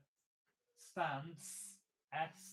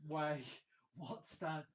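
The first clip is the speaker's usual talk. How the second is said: ordinary delivery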